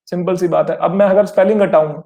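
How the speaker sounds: ordinary talk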